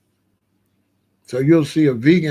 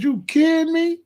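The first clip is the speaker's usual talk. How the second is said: imitating his own disbelief, far higher; incredulous; 11 semitones higher than usual. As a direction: squeak out the retold shock. Retold reaction to cloth tops.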